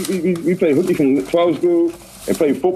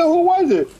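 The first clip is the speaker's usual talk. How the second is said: high pitched, pressing mock disbelief; incredulous question. Podcast host voicing Kevin Samuels grilling a guest, high and incredulous. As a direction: press her for a name, acting stunned. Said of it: "He said".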